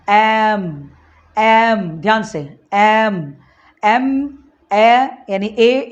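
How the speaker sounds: एम repeated, then stretched very slowly into ए; sound demo. Shown as a demonstration. Teacher repeats एम so students hear the ए sound. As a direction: repeat एम and draw it into ए. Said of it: "आपको उसका sound सुनना है".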